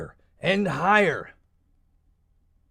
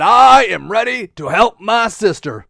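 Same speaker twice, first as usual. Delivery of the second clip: put-on heroic space boy; 3 semitones higher, 7 dB louder than usual. Hero announces readiness.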